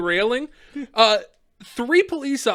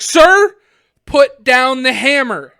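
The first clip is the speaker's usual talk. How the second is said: loud, slow, raised-pitch cop command; imitated cop shouting an order; mock stern order; 4 semitones higher, 10 dB louder, slower than usual. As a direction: space it out like a final police warning. Retold cop repeats order, louder.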